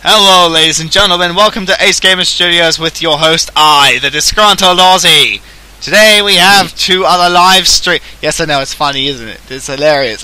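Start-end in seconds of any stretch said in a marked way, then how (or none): 0.0-8.0 loud, punchy announcer greeting; show host intro. Acted. Streamer introducing himself to his audience, booming host intro.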